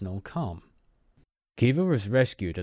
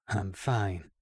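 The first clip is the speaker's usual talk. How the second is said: cold; 3 dB quieter than usual. Acted. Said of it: "his voice cold".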